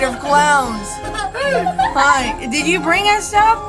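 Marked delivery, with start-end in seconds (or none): none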